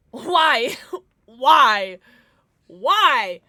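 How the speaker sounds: shouted, incredulous why; loud why. Real, not acted. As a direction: yell why at the screen. Reacting to ominous sound.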